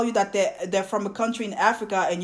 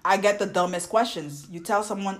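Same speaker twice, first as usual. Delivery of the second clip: usual speaking voice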